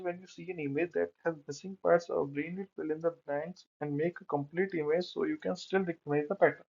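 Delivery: slow, careful reading from a slide; slow text reading. Real, not acted. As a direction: read it word by word. Reads slide text aloud. (धीरे-धीरे पढ़ना)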